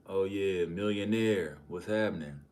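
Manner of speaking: loud, hyped-up greeting; excited shout. Real, not acted. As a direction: call it out big for the millionaire. Hyped greeting to a rich viewer.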